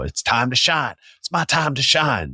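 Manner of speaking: high-pitched eager actor imitation; mock showboating. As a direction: play the actor seizing the spotlight. Mocks eager auditioning actor.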